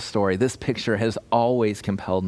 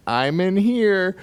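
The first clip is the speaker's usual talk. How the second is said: high, drawn-out sing-out; voice from the bathroom; 10 semitones higher, 4 dB louder, slower than usual. Retold or imitated. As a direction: imitate someone calling out slowly from the bathroom. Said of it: "heard a voice from the bathroom say".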